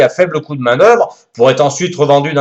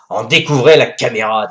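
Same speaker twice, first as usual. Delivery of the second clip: ordinary delivery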